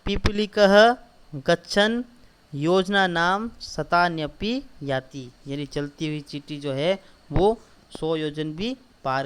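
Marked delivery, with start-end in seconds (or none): 0.0-5.3 slow, loud, word by word for writing down; slow dictation. Shown as a demonstration. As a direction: dictate it slowly so children can write. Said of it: "सबसे पहले ही लिखेंगे". (धीरे-धीरे बोलकर लिखवाना)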